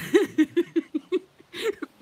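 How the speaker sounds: real high cackling laugh; high laughter. Real, not acted. Telling viewers about the checkout, high loud laugh.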